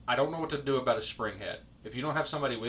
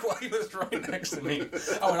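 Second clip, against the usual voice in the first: normal talking voice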